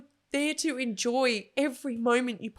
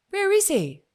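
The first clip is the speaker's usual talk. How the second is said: put-on higher, louder little-girl voice; child's question; 8 semitones higher, 6 dB louder than usual. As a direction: lightly play the curious daughter.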